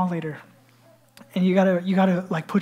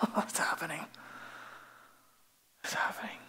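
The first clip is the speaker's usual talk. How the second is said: hushed, anxious aside; nervous whisper; 2 semitones lower, 14 dB quieter than usual. Acted. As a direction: whisper it under your breath. Peter nervous on walk with Jesus.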